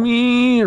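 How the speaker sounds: very high mimicking meow; hammed-up crowd meow; mocking moan. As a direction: copy the moan back at him as a sick joke. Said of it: "everybody in the crowd just going".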